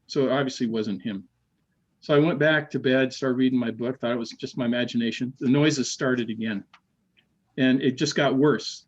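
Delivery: normal talking voice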